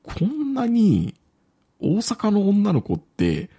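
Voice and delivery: high, slow, baffled wondering; puzzled surprise. Retold or imitated. Reading the Osaka chats, mock-baffled, high and slow. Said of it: "みたいな感じで".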